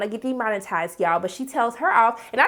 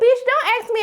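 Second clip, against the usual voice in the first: high-pitched, sassy retort; indignant comeback; 12 semitones higher than usual. Acted. Vlogger answering the boyfriend's "are you okay", indignant. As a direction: fire it back, offended by the question. Snapping back at boyfriend's question.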